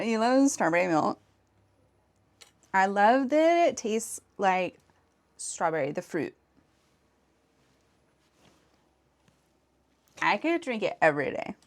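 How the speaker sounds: put-on sweet valley girl tone. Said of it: "my Valley Girl voice".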